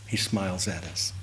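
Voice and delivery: ordinary delivery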